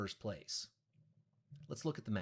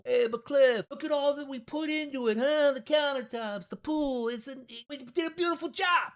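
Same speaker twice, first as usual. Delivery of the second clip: much higher and louder, a hammed-up Brazilian accent that slips into New Yorker; botched Brazilian accent, pitched way up and pushed loud; failed Brazilian accent; 13 semitones higher, 9 dB louder than usual. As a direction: play the pleading client in a clumsy Brazilian-turned-New-York accent, high and loud. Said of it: "that was almost like a New Yorker accent".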